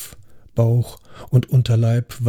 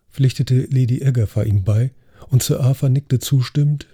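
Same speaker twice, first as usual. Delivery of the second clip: ordinary delivery, nothing marked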